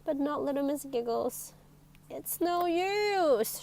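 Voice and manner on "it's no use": slow, drawn-out sigh of defeat. A narrator's reading. Girl sighing slowly that her giggle is gone. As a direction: let the words sag slowly like a sigh. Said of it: "she sighed".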